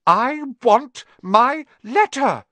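slow, high, furious shout. A narrator's reading. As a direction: shout each word as a demand. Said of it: "he shouted".

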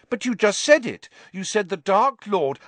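high, loud protest. A narrator's reading. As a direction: insist she said it. Harry protests.

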